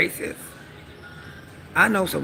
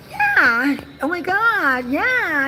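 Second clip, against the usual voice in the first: much higher, louder squeaky put-on; real high voice; 13 semitones higher, 3 dB louder, much slower than usual. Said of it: "I made my voice go real high".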